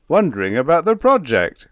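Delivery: loud, high, impatient snap; snapping. A narrator's reading. General to his confused companion, snapping. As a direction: snap it sharply, pitch and volume up. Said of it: "snapped the General".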